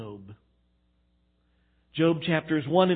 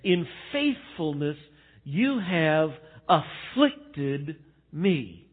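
slow, weighted phrase with long-held words; deliberate, drawn-out emphasis on every word; letting it sink in; much slower than usual. Real, not acted. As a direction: draw each word out so it sinks in. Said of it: "Let that last phrase sink in".

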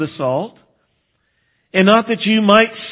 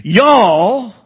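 Y'all in a Texas drawl, raised and louder; 4 semitones higher, 6 dB louder than usual. Shown as a demonstration. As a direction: throw in a Texan Y'all, pitched up and louder. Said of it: "so I'll say that 'cause I grew up there".

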